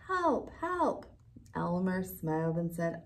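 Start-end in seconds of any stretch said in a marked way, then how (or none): 0.1-1.1 calling for help. A narrator's reading. Wilbur faking a cry for help, higher. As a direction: call out for help. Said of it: "another voice called".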